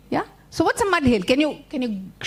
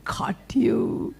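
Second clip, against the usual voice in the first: breathy villain whisper; gloating Anansi; 4 dB quieter than usual. Acted. Anansi gloats over trapped rabbit, whispering. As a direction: whisper it in triumph. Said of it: "And then Nancy's like".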